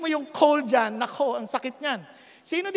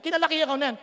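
normal talking voice

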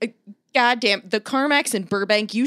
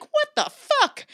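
shocked squeal. Retold or imitated. Shocked at huge line.

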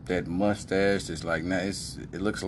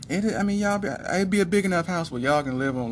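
daughter's voice; 9 semitones higher than usual. Retold or imitated. Daughter voiced in retold chat.

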